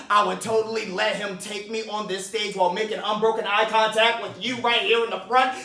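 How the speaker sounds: ordinary talk, nothing marked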